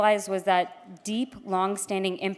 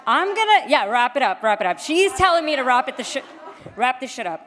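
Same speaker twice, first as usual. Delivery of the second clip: laughing, rushed and loud; laughing while hurried; 4 semitones higher, 7 dB louder than usual. Real, not acted. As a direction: giggle through being cut off. Being hurried off stage.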